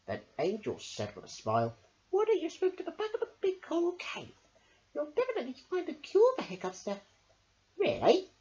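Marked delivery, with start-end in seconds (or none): 2.1-7.0 high, fast scheming suggestion; sly Angel Fish. A narrator's reading. Angel Fish setting up the secret surprise, fast and high. 7.8-8.3 hopeful really. A narrator's reading. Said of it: "cried Smiley Shark".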